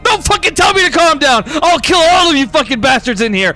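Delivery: high, loud threatening rant; furious yelling. Acted. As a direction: rage at those calming you down. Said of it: "Ventari's yelling".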